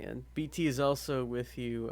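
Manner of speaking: ordinary talk, nothing marked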